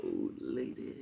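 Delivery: very low, soft mumble; lightly voiced nephew, very low; imitating nephew. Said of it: "what you say, bruh?".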